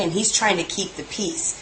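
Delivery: ordinary delivery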